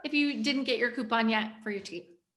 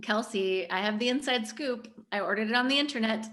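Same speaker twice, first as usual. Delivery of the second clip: ordinary talk, nothing marked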